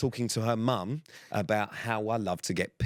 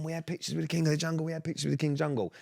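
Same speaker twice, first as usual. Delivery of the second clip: put-on quick, pitched-up childish gushing; excited kids; 6 semitones higher, faster than usual. Host imitates children bragging to parents, giddy and quick.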